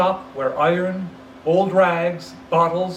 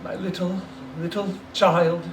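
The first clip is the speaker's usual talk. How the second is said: breaking down; 3 semitones higher than usual. Acted. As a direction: hold back the sob. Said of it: "He broke down all at once".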